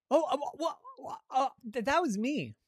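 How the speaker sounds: high, halting cover-up; flustered stammer. Acted. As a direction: stumble over the words like someone caught out. Avery caught out, covers up.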